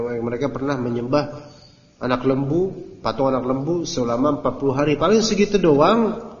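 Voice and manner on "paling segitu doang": higher, louder cocky mock boast; mock bravado. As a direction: sneer it like a careless bro. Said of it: "Mereka berkilah gini nih".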